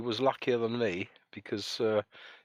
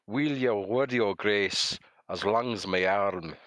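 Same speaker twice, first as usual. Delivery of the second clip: slow, careful attempt at a Scots accent on the Burns poem; a slightly raised, halting Scottish reading; rubbish Scottish accent; slower than usual. Shown as a demonstration. Host attempting Address to a Haggis for viewers, in a poor Scottish accent. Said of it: "my Scottish accent is rubbish".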